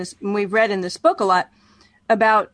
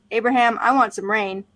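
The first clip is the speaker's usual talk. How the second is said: usual speaking voice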